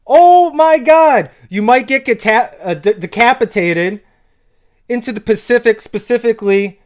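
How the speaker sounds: high and loud, can't believe it; excited yelling. Real, not acted. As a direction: gush over the lyric, almost shouting. Blown away by shark bar.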